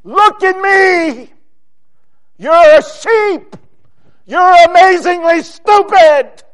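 high, loud, drawn-out shout; slow, forceful rebuke shouted at full voice; the Lord scolding. Acted. The Lord berating his foolish sheep, shouted scolding. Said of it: "I need the Lord to say".

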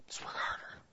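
soft unvoiced whisper of Let's work harder; hushed whispered repeat. Shown as a demonstration. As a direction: breathe it like a secret. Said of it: "repeated as a whisper".

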